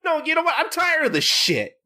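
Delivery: high, exasperated outburst; fed-up Gintoki. Retold or imitated. Gintoki finally snapping at Zero, high fed-up voice. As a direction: snap like you've finally had enough.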